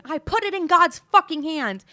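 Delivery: high, loud screamed outburst; screaming. Retold or imitated. Daughter screaming at her mom on the phone outside, furious yell. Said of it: "and I'm screaming".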